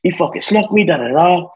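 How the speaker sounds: high, upset he snuck me; retold tearful anger, pitch way up; angry whine. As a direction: vent the hurt, voice cracking upward. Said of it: "like, anger".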